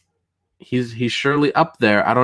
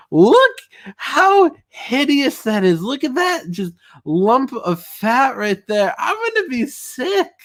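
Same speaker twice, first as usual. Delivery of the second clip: much higher, slow, squealing with disgust; mock disgust; 11 semitones higher, slower than usual. Streamer mocking a drawing to chat, high slow disgust. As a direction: recoil from the picture in hammed-up horror.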